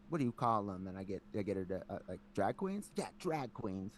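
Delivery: ordinary talk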